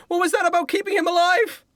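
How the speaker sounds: high-pitched yell across a distance; scout yelling over. Acted. Scout yells over to the shark, high-pitched yell. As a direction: call out in a high, indignant scout voice. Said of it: "the scout yells over to the shark".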